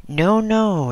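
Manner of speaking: high-pitched, mildly exclaimed protest; exclaimed refusal. A narrator's reading. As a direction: exclaim it high, politely declining an offer. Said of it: "exclaimed the old hermit".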